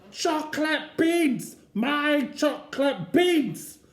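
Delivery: high, loud, stretched-out wail; toddler wailing. Acted. Eddie wrenching the trolley bars, wailing for chocolate beans. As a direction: drag out the cry like a furious toddler.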